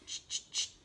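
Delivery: whispered ch, ch, ch; unvoiced teacher ch sound demo. Shown as a demonstration. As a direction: breathe out a sharp voiceless ch three times. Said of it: "C H".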